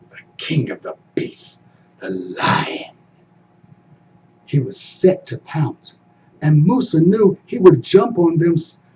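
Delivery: usual speaking voice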